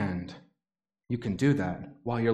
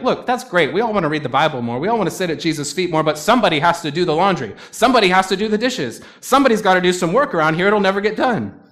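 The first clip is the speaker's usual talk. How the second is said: higher and louder, fast, building protest; a mock frazzled Martha, half-pleading; exasperated objection; 3 semitones higher, 5 dB louder than usual. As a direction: rattle off the chores like an overworked host. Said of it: "You're like".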